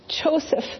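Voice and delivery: a high, breathless gasp of the name. Acted. Mary in labor calling her husband, gasping. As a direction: catch your breath on the word. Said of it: "Mary gasped".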